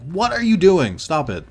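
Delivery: loud, high-pitched retold outburst; acting out a scandalized telling-off; exasperated protest. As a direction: burst out in appalled objection. Retold protest at date's rudeness.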